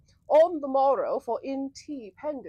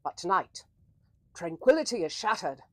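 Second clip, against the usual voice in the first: dramatic narration; 4 dB louder than usual. Acted.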